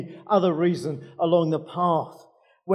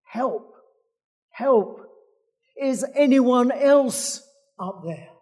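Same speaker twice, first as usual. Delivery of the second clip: higher, louder comic cries; yelling for help; 7 semitones higher, 6 dB louder, slower than usual. Acted. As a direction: yell up for someone else. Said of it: "Jack yells again".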